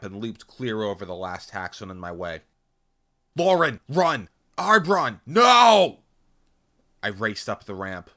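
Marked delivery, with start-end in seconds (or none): 3.4-6.0 high, loud cries building to a scream; urgent warning shouts. A narrator's reading. Narrator warning human and comrade, frantic yelling. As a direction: yell frantically across a battlefield.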